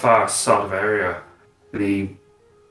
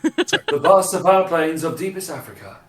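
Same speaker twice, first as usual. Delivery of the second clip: slow, hushed wildlife-film narration; nature documentary narrator; 8 semitones higher, slower than usual. Acted. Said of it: "nature documentary narration".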